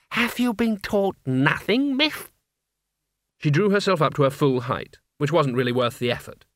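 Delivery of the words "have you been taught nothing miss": high, slow sneering gargoyle. A narrator's reading. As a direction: look down your nose at her. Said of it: "The knocker perceptibly sneered".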